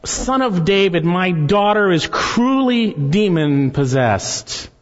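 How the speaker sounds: high, drawn-out plea; crying out for mercy. Acted. Mother begging the Lord for her possessed daughter, crying out. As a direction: call out slowly, imploring help. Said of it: "began to cry out".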